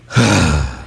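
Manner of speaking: long, low, heavy exhale; mock fed-up huff; imitated bored sigh. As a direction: sigh like a sullen listener. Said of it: "he's just like".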